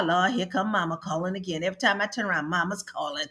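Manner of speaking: low, soft grumble under the breath; muttered complaint. Retold or imitated. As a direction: sigh it out like a tired daughter in the car. Voicing the mother's car complaint.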